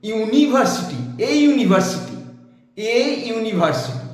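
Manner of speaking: ইউনিভার্সিটি begun with a clear yoo glide; teacher sounds out ইউনিভার্সিটি, stressing the yoo; repeating the example for the class; vowel yoo sound. Shown as a demonstration. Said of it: "ইউ এর উচ্চারণ ইউ এর মতোই হলো".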